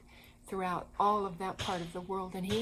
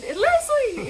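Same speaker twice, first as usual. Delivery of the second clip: high, loud joyful cry; excited shout; 12 dB louder than usual. Retold or imitated. Boris recognizes Kevin at the meeting, a high joyful shout. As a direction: shout it like you've found a long-lost friend.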